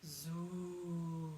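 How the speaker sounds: low open chest hum on zoo; low zoo resonance. Shown as a demonstration. Voice coach leading a resonance ladder, low open zoo. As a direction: sink into the chest and sound it. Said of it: "we'll go back down".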